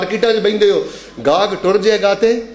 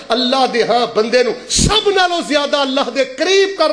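high-pitched sermon warning; fervent preacher's raised pitch; impassioned preaching; 5 semitones higher than usual. Real, not acted.

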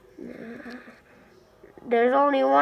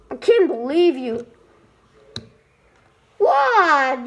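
normal talking voice

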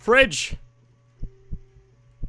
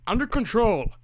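ordinary talk

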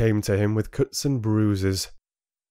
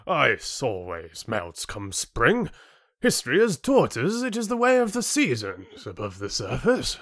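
condescending chieftain, amused; 5 semitones higher than usual. Acted. Chieftain dismissing Rin's warning, condescending. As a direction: brush off the warning loftily.

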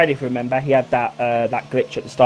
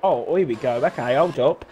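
ordinary talk, nothing marked

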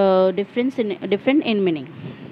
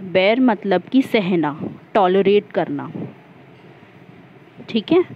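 ordinary talk

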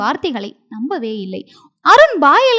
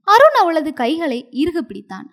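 ordinary delivery

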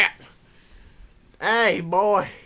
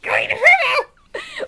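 put-on drunk yell; 11 dB louder, much faster than usual. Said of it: "What are you drunk?".

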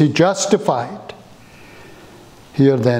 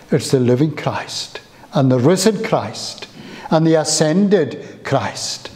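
usual speaking voice

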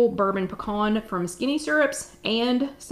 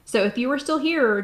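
ordinary talk, nothing marked

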